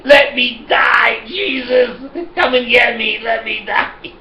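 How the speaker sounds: high, drawn-out mock plea to heaven; hammed-up wailing. Customer hearing the insulin price, hammed-up despairing cry. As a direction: wail for mercy in comic despair.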